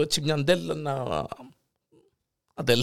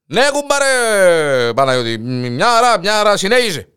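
loud strained reply; 6 semitones higher, 8 dB louder than usual. Acted. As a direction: shout back mid-act, straining. Strained answer during sex. (δυνατή, τεντωμένη απάντηση)

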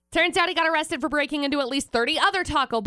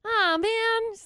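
higher cartoon fox whine; Swiper voice; 9 semitones higher than usual. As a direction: moan it like a foiled cartoon thief. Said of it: "Next time we'll get you, Swiper".

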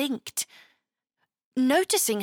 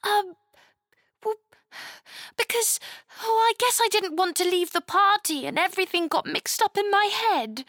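put-on higher, fast, faltering girl voice; sadly; 10 semitones higher, faster than usual. Chloe explaining her outburst, sad and hurried. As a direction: mumble the excuse sadly and hurriedly. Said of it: "Chloe said sadly".

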